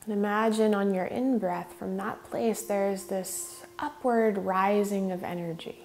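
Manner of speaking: measured meditation lead with long pauses; guided meditation. Real, not acted. Yoga teacher leading students in regulating the breath, guided meditation. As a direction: guide them unhurriedly, leaving space between phrases.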